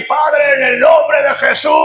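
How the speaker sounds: high, put-on holy prayer voice; mock anointed voice. Preacher mocking how not to enter prayer, high affected anointed voice. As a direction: exaggerate a strange, over-anointed praying voice. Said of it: "no ponga voz de, de, de ungido".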